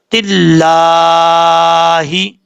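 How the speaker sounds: slow تل لا ہی with the final ہا silent; stopping on ha. Shown as a demonstration. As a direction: stretch it out and stop on a silent ہا. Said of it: "جب stop کریں گے تو ہا ساکن ہو جائے گا".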